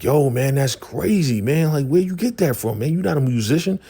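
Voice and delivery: high, hyped-up mock disbelief; amazed reaction. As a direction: act out high, incredulous excitement. Acting a friend amazed by the sound.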